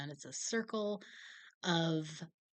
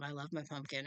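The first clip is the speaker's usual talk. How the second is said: ordinary delivery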